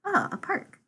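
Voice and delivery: high-pitched little lift of interest; brightening up. Acted. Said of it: "they seem to brighten up a little".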